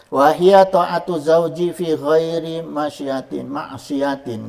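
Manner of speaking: dibaca pelan dan lantang seperti kitab; slow Arabic recitation. Shown as a demonstration. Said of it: "reciting Arabic text slowly". (bacaan kitab yang lambat dan keras)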